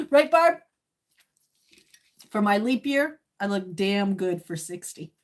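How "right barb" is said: higher, louder call to a friend; playful shout to a friend. Real, not acted. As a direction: call across to Barb, teasing. Calling out again.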